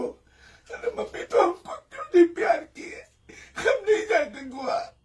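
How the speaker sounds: put-on high, tearful child's wail, voice breaking into sobs; imitating child sobbing. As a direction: imitate a little girl crying to her mother. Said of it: "और रो रही है". (रोती हुई बच्ची की ऊँची आवाज़ की नकल)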